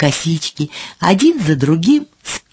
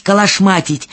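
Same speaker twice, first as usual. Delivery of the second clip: usual speaking voice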